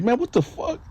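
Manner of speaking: very high-pitched, indignant disbelief; startled outburst. Retold or imitated. Narrator jumps up at the man confronting him, startled. Jumps up, challenged in his cell.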